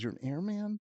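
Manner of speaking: high, rising disbelief; mock-baffled dad, pitch leaping up; incredulous dad. Dad questioning his airman son, incredulous. Dad doubts the title again.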